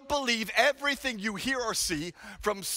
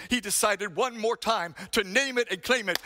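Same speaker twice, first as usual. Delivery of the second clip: ordinary talk, nothing marked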